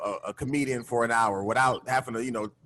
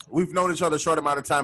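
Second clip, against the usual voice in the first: ordinary talk, nothing marked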